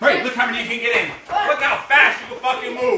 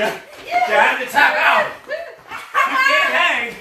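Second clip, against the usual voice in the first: slow, loud, higher jeer; taunting shout; 10 semitones higher, 4 dB louder, slower than usual. Real, not acted. Coach taunting the trainee who tapped out, loud and slow. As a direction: rub it in, drag each word.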